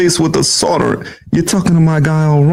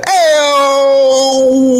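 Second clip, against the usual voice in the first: Dice impression; 12 semitones higher than usual. Shown as a demonstration. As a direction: belt it like Andrew Dice Clay. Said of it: "That's not bad".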